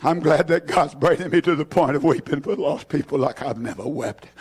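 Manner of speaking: low, choked-up, tearful confession; fighting back real tears as he speaks. Real, not acted. Preacher apologizing to church for his tears, tearful. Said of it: "I'm sorry for the tears this morning".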